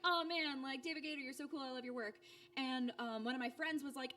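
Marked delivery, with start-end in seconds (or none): 0.0-2.1 higher, gushing fan voice; fangirl gush. Retold or imitated. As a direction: gush at your hero like a starstruck fan. Gushing at a game developer.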